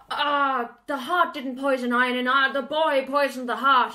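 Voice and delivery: hammed-up, loud dragon voice; Draco outburst. Draco corrects Bowen about the poisoned heart, hammed-up and loud. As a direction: play the dragon protesting loudly. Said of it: "And then Draco's like".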